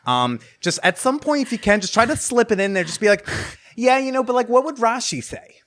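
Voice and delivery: rabbi impression. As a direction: play the rabbi giving advice. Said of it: "You did a good rabbi impression".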